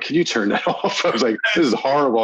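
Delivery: light, amused exasperation; mock exasperated plea. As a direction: retell an embarrassed plea to switch it off. Retold plea during embarrassing documentary.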